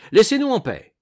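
loud, high annoyed outburst; irritated shout. A narrator's reading. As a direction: snap at the maid loudly. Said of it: "s'écriait Pincemaille".

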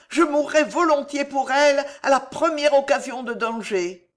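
high, earnest pleading; pleading devotion. A narrator's reading. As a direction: swear loyalty while begging, pitched well up. Alice pleads devotion to queen.

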